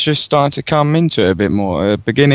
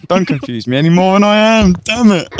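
higher-pitched, playful exasperation; flustered protest; 5 semitones higher than usual. Real, not acted. Pundit heckled by co-hosts over his maths, flustered protest. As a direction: protest at being muddled, pitch jumping up.

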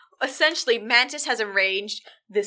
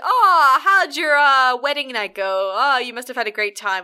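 mock teasing coworkers; 3 semitones higher, 7 dB louder than usual. Workmates teasing the groom.

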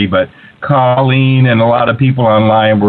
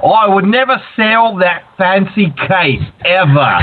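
high-pitched impression of an Australian host; exaggerated Australian accent, pitched well up; Steele; 9 semitones higher than usual. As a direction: mimic the host with lilting, mock indignation. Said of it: "say in Steele's voice".

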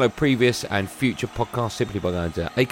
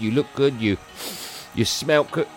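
normal talking voice

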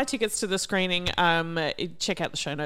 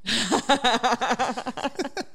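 high laugh; 4 semitones higher than usual. Real, not acted. Laughing at innuendo.

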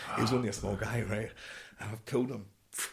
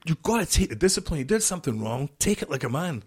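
higher, pressing commands; urgent orders; 8 semitones higher, 5 dB louder than usual. Retold or imitated. Sergeant major urging a soldier to take the discipline, higher and pressing. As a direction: urge him to hold it together. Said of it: "my sergeant major saying".

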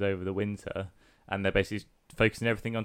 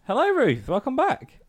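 bright, much higher welcome; high sing-song greeting; 14 semitones higher, 6 dB louder than usual. Real, not acted. Greeting returning co-host.

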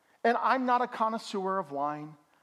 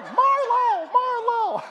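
very high, loud, hammed-up cry; mock high call; 9 dB louder, much slower than usual. Pastor joking about wine names, high mock call. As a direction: sing out the name way up high, loudly. Jokey calling of wine name.

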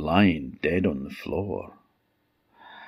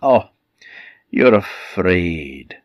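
disguised queen; 5 dB louder, slower than usual. Acted. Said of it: "said the wicked queen".

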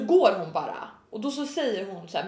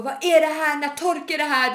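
higher, louder mock complaint; irritated; 6 semitones higher, 5 dB louder than usual. Customer asking when nails dry, mock irritated. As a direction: imitate a customer losing patience. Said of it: "mer och mer irriterade".

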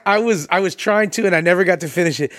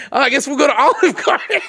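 high, laughing imitation of giving in; mock feigned reluctance; 8 semitones higher than usual. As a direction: tease a friend's fake resignation through a laugh. Teasing imitation of friend's feigned reluctance.

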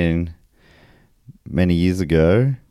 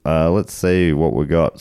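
normal talking voice

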